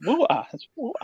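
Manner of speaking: disbelieving, spelling it out for her; exasperated. Retold or imitated. Exasperated, repeating to the cashier.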